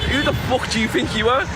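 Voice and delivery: high-pitched challenge, acted back; retold outrage. Acted comeback in retold quarrel.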